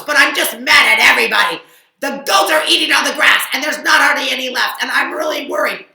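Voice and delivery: loud, fast angry rant; loud fast complaining shout; furious sheep. Acted. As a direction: pour out the anger. Sheep furious about goats.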